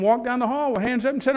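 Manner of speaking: normal talking voice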